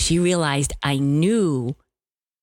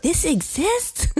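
amazed disbelief; 11 semitones higher, slower than usual. Real, not acted. Amazed at the card.

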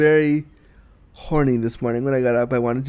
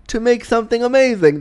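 higher-pitched, hammed-up British accent; bad British accent; 8 semitones higher, 3 dB louder than usual. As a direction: put on a deliberately dodgy British accent, pitched up. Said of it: "That was my really bad British accent".

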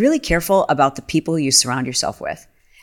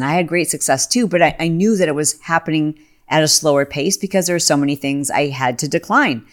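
usual speaking voice

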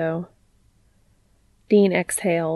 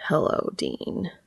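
slow, soft, sleepy rumble; low morning rumble; 5 dB quieter, slower than usual. A narrator's reading. Cass greets Dean on waking, low morning rumble. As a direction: rumble it softly, just woken. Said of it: "Cass rumbled, in an impossibly low morning voice".

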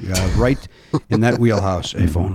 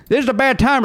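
put-on horrible Southern accent; 9 semitones higher than usual. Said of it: "I always put on a horrible Southern accent".

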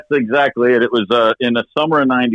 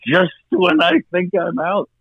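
higher, slow Godfather-style Al Pacino line; Pacino impression; 9 semitones higher, slower than usual. Telling his wife about Jimmy's tour, in a hammed-up Al Pacino voice. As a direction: play it like Michael Corleone being dragged back. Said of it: "I went full Pacino".

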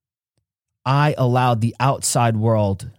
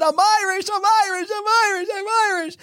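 put-on much higher, thrilled chant of a happy kid; excited child. Boy running up the block, much higher, thrilled chant. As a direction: chant it like a kid who just got great news. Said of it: "I was so excited".

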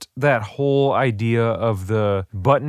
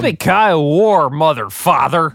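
mock catchphrase; 4 semitones higher, 5 dB louder than usual. Podcast opening catchphrase bit.

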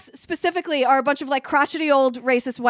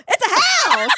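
high, affronted mock outburst; Murdoch, mock indignant; 10 semitones higher, slower than usual. Podcaster imitating rich caller answering dispatcher, mock indignant. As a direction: exaggerate offence at being asked. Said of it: "And, he, and Murdoch goes".

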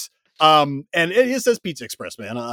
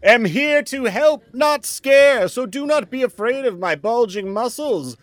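booming arrogant alien overlord; 9 semitones higher than usual. Acted. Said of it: "can Pizza Express Man say hello".

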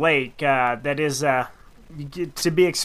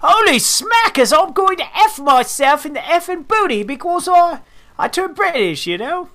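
high, loud mock Australian slipping into British; hammed-up Aussie voice that turns British midway; Australian accent drifting British; 14 semitones higher, 4 dB louder than usual. Co-host attempts the angler's Australian accent, high and hammed-up. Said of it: "I shouldn't do the Australian".

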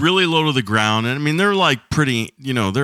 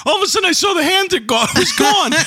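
rising excitement; 14 semitones higher than usual. Retold or imitated. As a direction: voice climbing much higher as the story peaks. Retelling the shocking spin.

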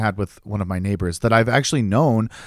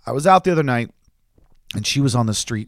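plain reading voice

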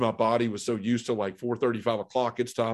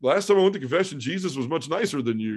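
cheeky retort; 6 semitones higher than usual. Retold or imitated. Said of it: "I was like".

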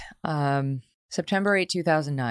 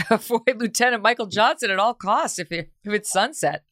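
higher-pitched, half-laughing warning; amused mock warning; 6 semitones higher than usual. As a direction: warn in mock dread, laugh breaking in at the start. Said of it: "which made me think".

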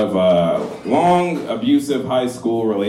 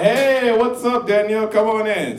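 higher, bright mock welcome of his younger self; eager greeting; 9 semitones higher than usual. Young him greeting Danielle at the door, high eager welcome. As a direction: play the keen nineteen-year-old inviting her in. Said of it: "I'm like".

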